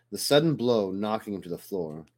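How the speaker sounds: plain reading voice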